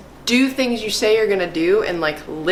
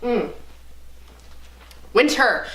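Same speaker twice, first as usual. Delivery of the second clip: ordinary talk, nothing marked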